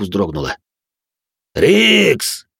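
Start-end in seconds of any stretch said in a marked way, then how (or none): 1.5-2.5 sharp high call from behind; shout. A narrator's reading. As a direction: yell the name to warn him. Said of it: "крикнул кто-то сзади". (громкий окрик)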